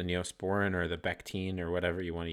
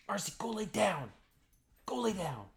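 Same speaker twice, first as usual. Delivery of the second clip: high, firm call to the dog; dog command voice; 13 semitones higher than usual. Real, not acted. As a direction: order the dog to lie down. Yelling at the dog.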